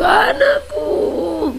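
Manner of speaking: high, very slow crying wail; mourning a lost child, sobbing wail. Retold or imitated. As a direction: wail like a parent over a dead child. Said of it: "seperti menangisi anak yang telah mati".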